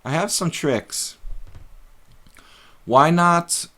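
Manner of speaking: normal talking voice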